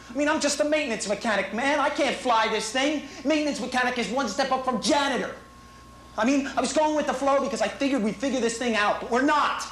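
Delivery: high, frantic, whiny rant; trembling whiny yelps. Real, not acted. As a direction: plead like you're scared to die. Panicked rant into tape.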